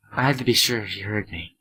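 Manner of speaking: normal talking voice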